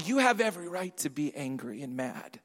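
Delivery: low, hushed insinuation; stranger's tempting voice. Acted. Preacher voicing the stranger feeding a grudge, low and hushed. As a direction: softly egg them on, pitch dropped. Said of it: "The stranger's voice comes".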